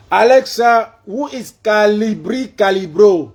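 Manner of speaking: loud, high call to a voice assistant; shouted voice command. Real, not acted. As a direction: project every syllable, slowly. Calling out to voice assistant.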